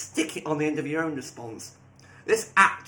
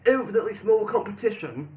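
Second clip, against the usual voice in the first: "infinitely small competition" higher and punched; capitalised emphasis; 5 semitones higher, 4 dB louder than usual. Acted. Reading the AI's capitalised words, "infinitely small competition" raised for emphasis. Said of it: "it goes and capitalizes".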